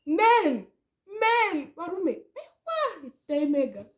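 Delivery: loud, high shocked yelps; shocked outcry. Retold or imitated. Hearing the crush got back with his girlfriend, shocked outcry. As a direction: cry out in disbelief. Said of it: "I'm like".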